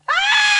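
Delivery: long high scream breaking into sobs; full-force wail of horror; anguished scream. Acted. Woman overcome by horror at the disaster, anguished scream. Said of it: "I scream".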